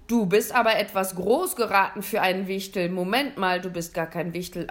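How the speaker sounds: loud, puzzled Santa working it out aloud; hearty, fascinated Santa; booming Santa, curious. A narrator's reading. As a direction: size up a strange visitor in a big voice. Santa inspects the boy.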